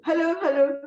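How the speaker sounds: two bright, loud hellos; calling out. Retold or imitated. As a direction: call out to him across the room. Calling out to get attention.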